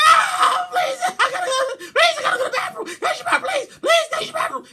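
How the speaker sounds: high, loud, laughing plea; frantic mock begging; desperate pleading. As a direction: beg for the bathroom. Acted desperate bathroom plea.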